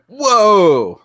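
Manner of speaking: an impressed gasp of whoa; mock amazed whoa, high and loud. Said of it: "It wasn't".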